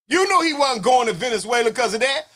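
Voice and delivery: usual speaking voice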